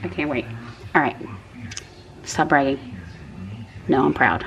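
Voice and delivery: normal talking voice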